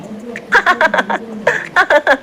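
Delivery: high, open laughing burst; loud girlish laughter. A narrator's reading. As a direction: laugh out at her friends. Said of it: "Mansi जोर जोर से हंसने लगी". (ज़ोर ज़ोर से हँसी)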